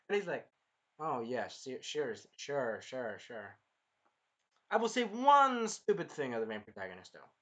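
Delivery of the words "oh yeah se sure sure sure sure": low, trailing-off string of agreement; mock placating. Imitating the other guy's reply to the outburst, mock placating. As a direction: humour a shouting person, voice dropping away.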